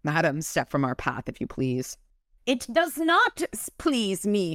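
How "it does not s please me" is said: high, slow spat-out refusal; sudden, vicious. A narrator's reading. The fairy refusing Michael, spat and vicious. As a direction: spit it out, drawing out the words. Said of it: "she spat, sudden and vicious".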